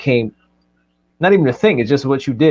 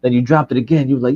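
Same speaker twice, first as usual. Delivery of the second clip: ordinary delivery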